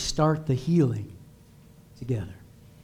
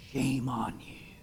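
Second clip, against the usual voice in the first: softer accusing whisper, no voice; shaming whisper; 4 semitones lower, 11 dB quieter than usual. Retold or imitated. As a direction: hiss the blame in a whisper. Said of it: "they usually do it like this".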